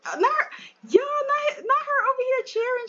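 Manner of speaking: high-pitched, amused squeal; excited teasing. Real, not acted. Player tells viewers about her cheering, thrilled and teasing. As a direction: exclaim it high, delighted and teasing.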